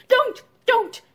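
put-on loud, high child's protest; acted cry of terror, short and high; crying and screaming. A narrator's reading. Boy heard through the door, crying out in fear. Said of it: "crying and screaming".

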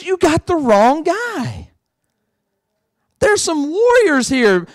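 high, slow, disbelieving Gideon; doubting Gideon. Retold or imitated. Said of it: "He goes".

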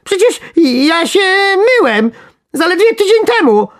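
very high, loud wailing; panicked squeal. A narrator's reading. Worm dreading the water, panicked wail. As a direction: shriek as a terrified little worm. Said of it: "kwiknął w absolutnej panice".

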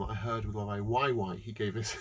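ordinary talk, nothing marked

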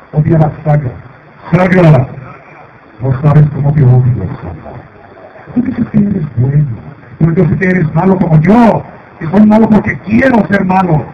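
loud gloating villain, building to a shout; mustache twirling villain. Acted. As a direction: ham up the evil threat. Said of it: "Canon es malo".

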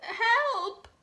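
high, trembling frightened cook voice; scared cook. Acted. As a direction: squeak it out like a terrified little cook. Cook cries for help.

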